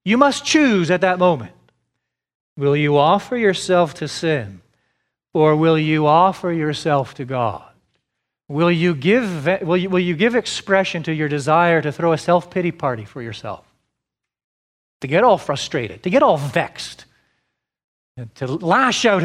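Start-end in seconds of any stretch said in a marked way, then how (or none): none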